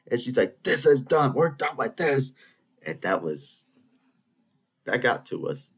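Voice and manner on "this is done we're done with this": mock-furious teacher declaring it finished; worked-up teacher. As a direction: imitate an exasperated high outburst. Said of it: "she starts getting all worked up".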